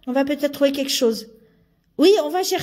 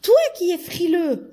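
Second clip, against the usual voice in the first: put-on higher, girlish elf voice, scolding; a girl elf scolding her friend, voice pitched higher; 6 semitones higher than usual. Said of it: "j'ai oublié de faire la voix de fille".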